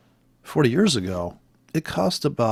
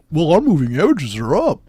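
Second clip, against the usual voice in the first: mocking trader voice; 6 semitones higher, 4 dB louder than usual. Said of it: "'Cause they're like".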